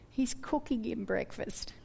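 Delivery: near-whispered answer to your own question; quiet, tender punchline to the story; hushed reveal. Real, not acted. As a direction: drop to a soft, breath-light hush. Hushed reveal of Jesus' mercy.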